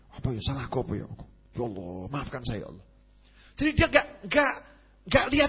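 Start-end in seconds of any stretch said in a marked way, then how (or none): 0.1-2.9 soft, low plea to God; hushed prayer. Retold or imitated. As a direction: pray under your breath. Man quietly begs God's forgiveness.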